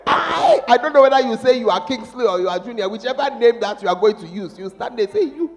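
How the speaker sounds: loud, high preaching with a laugh. Real, not acted. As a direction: push it out over the congregation. Father giving daughter away, loud.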